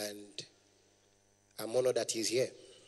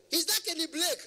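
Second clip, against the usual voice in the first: high-pitched mock caller; imitated shrieky phone voice. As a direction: ask it way up high, insistently. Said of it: "their voice is very, very high".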